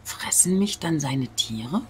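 slow anxious whisper; worried whisper. Real, not acted. Gamer fearing the animals will eat him, slow whisper. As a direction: whisper it as if the beasts could hear.

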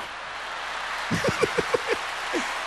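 high wheezing laugh; high breathless laugh. Real, not acted. Preacher laughing at his own joke, high breathless laugh.

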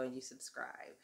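whisper. Real, not acted. Whispered aside after plea.

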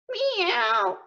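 high mock meow; cat meow. Shown as a demonstration. Teacher showing animal sounds, high meow. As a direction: mew like a cat. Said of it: "the animal sounds like".

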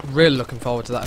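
ordinary talk, nothing marked

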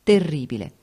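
ordinary delivery, nothing marked

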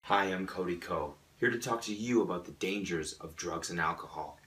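soft, low speaker's address; hushed, solemn PSA delivery; earnest public warning. Acted. Speaker warns audience about drugs, quiet and low.

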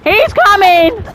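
high, loud cry for help; alarmed shout. Real, not acted. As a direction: holler as it comes at you. Animal coming at the speaker.

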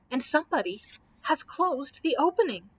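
ordinary delivery

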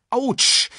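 high, loud little yelp; cry of pain. Acted. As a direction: yelp at a sore back. Simba hurts his back falling.

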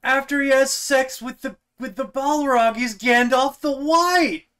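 loud, high rant stretching the last words; comic yelling. Acted. Co-host riffing on Gandalf and the Balrog, comic yelling.

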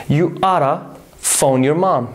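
ordinary talk, nothing marked

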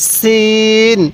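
সিন with a thin s and long-held vowel; thin seen. Shown as a demonstration. Teacher has learners read along, thin s in সিন. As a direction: keep the s of সিন thin, then stretch it evenly. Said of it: "সিনের উচ্চারণটা অনেকটাই চিকন".

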